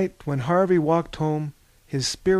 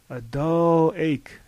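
echo; much slower than usual. A narrator's reading. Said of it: "echoed Anaximenes".

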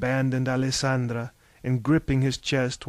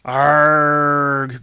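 loud, rough growled arg; 8 dB louder than usual. A narrator's reading. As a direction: growl it out like a frustrated warrior. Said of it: "growled Orestes".

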